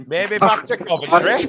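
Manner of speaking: slow, drawn-out Yorkshire accent imitation. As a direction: copy a friend's Yorkshire accent playfully. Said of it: "You said that in a very Yorkshire accent there".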